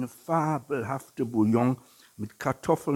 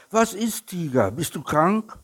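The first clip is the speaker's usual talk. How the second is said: higher, louder worried call; 10 semitones higher, 8 dB louder than usual. A narrator's reading. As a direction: call out the question as the little bear runs up. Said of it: "kam der kleine Bär gelaufen und rief". (laut und hoch gerufen)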